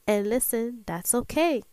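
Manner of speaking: ordinary talk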